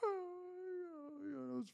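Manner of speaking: very high, soft wheeze of laughter; helpless real laughing squeal; crying laughing. Real, not acted. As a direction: barely get it out for laughing. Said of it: "I was crying laughing".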